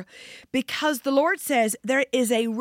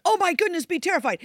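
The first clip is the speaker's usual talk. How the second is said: a higher, half-hearted mock alarm; mock panic; 8 semitones higher, faster than usual. Mocking a fearful reading of Jesus' warning, lightly panicky. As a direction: mimic a panicky warning without meaning it. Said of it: "he's not saying it there like".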